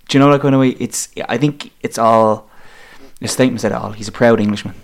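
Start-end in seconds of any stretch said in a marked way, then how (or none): none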